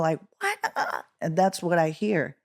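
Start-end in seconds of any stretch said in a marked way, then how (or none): 0.4-1.0 very high, soft imitated crowd roar; thin high-pitched mock scream. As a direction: imitate a far crowd yelling, held back. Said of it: "screaming and yelling".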